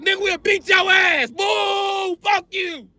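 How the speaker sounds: boo and jeer at the stage; heckling crowd. Retold or imitated.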